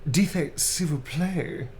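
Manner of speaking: slow, lightly swooning French; a hint of a blushing lover; romantic line. Reading a romantic line to chat, slowly in French. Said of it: "Romantic blushing type line".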